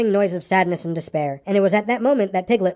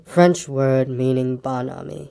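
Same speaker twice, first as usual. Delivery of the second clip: low, slow, glum explanation; gloomy; 6 semitones lower, slower than usual. A narrator's reading. Eeyore explains a word to Pooh, slow and gloomy. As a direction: explain it drearily in a low drag. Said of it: "went on Eeyore gloomily".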